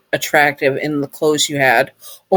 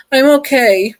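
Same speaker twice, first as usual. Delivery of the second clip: holding back tears; 9 semitones higher than usual. A narrator's reading. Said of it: "trying not to cry".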